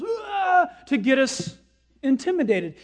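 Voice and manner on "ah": high mock-menacing aah; sudden scare noise to intimidate; drawn-out, put-on monster cry; intimidating "aah". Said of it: "comes up nonetheless and goes".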